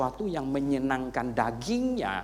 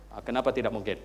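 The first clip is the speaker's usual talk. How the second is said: ordinary delivery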